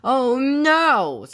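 high, loud cry of dismay; narrated cry of alarm; dismayed call. A narrator's reading. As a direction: call out as disaster looms. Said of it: "called Wolf".

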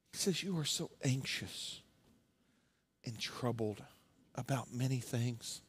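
soft, tender calling of her name; gentle Jesus. Retold or imitated. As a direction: speak softly, like calming a worried friend.

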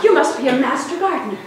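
ordinary delivery

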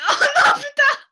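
high, loud dismayed wail; frustrated cry. Real, not acted. Hit by banana in race.

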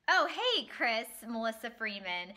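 bright greeting. Real, not acted. Switches into on-camera greeting.

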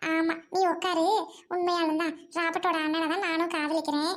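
ordinary delivery

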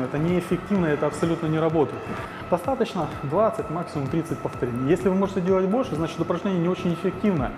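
usual speaking voice

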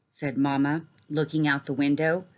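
plain reading voice

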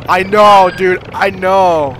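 loud, drawn-out I know; loud exasperation. Real, not acted. Player yelling slowly at a nagging teammate, loud. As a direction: shout back slowly in annoyance.

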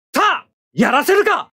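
high, forceful attack shouts; battle cries. Acted. Pilot attacking the enemy mobile suit, high forceful shouts. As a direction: yell them as each shot goes out.

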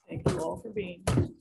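normal talking voice

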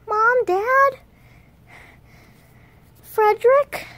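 high, loud questioning shouts; make-believe worried hollering, rising at the end; pretend searching call. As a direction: call out for missing family. Calling for missing family.

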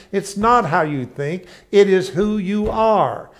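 loud, high, insistent sermon voice; fervent preaching. Acted. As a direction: press the point home to the crowd. Preaching at full force.

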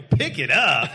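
incredulous, mildly indignant echo; alarmed disbelief. Retold or imitated. Husband to Kat about collecting the food, alarmed. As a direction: toss it back in disbelief. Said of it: "that's shocking, and I'm alarmed".

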